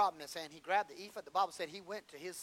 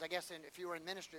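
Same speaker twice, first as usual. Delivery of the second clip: ordinary delivery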